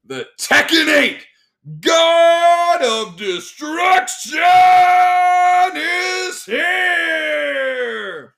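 booming drawn-out ring announcer call. Shown as a demonstration. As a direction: announce it like a fight night intro. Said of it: "So I should be a ring announcer".

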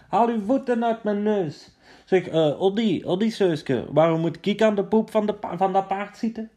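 high, fast complaining soldier voice; high whiny soldier. Acted. Cramped soldier complains to Odysseus, high whiny voice.

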